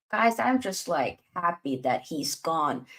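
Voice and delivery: usual speaking voice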